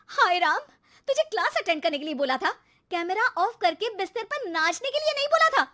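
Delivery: high, loud outraged scolding; exasperated scolding. Acted. Mother catching son dancing in bed, loud scolding. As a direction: let the outrage burst out.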